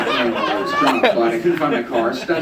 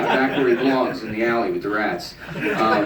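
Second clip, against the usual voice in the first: ordinary talk, nothing marked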